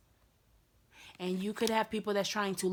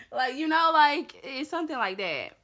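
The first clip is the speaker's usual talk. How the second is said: laughing; 4 semitones higher, 7 dB louder than usual. Real, not acted. Laughs at own acted reply.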